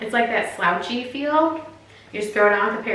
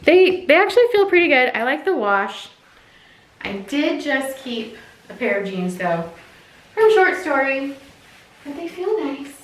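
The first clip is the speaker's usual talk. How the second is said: ordinary delivery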